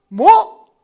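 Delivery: sudden loud "what?"; startled shout. Retold or imitated. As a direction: blurt it out, caught off guard. Father startled by son's remark.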